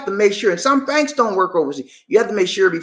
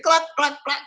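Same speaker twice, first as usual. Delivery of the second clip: high clucking like a hen; chicken clucking; 8 semitones higher than usual. Pretend. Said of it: "cluck like a chicken".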